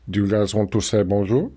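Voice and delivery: put-on low French-accented giant voice, bonjour rising; Andre the Giant impression. Andre the Giant greeting French fans at lunch, low French accent. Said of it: "Andre the Giant impression".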